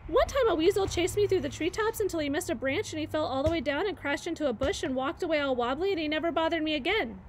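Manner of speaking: high, fast, eager babble; chattering squirrel. Acted. Chit Chat tells Bright Bill her life story, fast high chatter. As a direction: race through it like an excited young squirrel.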